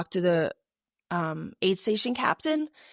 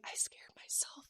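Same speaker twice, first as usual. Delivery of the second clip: very soft; 19 dB quieter than usual. Retold or imitated. Runner confiding in her friend after panic, very soft whisper. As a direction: whisper it as if the voice is gone. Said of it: "I also was losing my voice".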